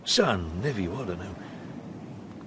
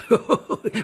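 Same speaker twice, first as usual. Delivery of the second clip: hearty laugh; 10 semitones higher, 4 dB louder than usual. Acted. Captain glad to escape wife.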